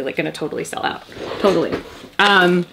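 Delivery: ordinary talk, nothing marked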